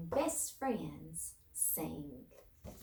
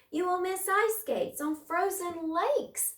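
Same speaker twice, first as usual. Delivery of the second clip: much higher, louder mouse voice; pleading mouse; 10 semitones higher, 5 dB louder than usual. A narrator's reading. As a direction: plead brightly like a tiny mouse.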